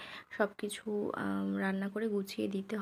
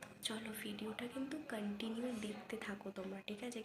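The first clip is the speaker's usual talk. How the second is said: hushed talk; 8 dB quieter than usual. Real, not acted. Vlogger waiting for midnight, quiet hushed voice. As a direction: keep your voice down, someone's asleep next door. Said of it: "এত আস্তে আস্তে কথা বলছি".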